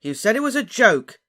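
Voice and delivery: loud, high, indignant yell; shouting. A narrator's reading. Ron accuses Harry of insulting beloved, shouting. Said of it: "shouted Ron".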